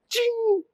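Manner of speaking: high bright chime sound; a sharp magical ping; mouth-made vanishing chime; magic ting. Acted.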